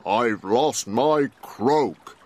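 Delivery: slow frog voice; slow, sad frog voice; Froggle. A narrator's reading. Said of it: "Froggle said".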